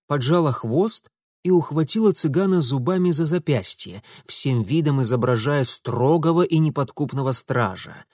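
usual speaking voice

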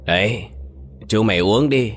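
lightly voiced drunk pushing a drink; a hint of a tipsy slur, coaxing; mild drunken urging; slurred drunk voice. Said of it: "nói bằng cái giọng lè nhè say rượu".

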